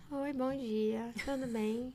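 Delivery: soft, very slow, swallowed greeting; mumbled inward greeting. Retold or imitated. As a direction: mimic a timid seller barely getting the words out. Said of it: "tem gente que fala pra dentro".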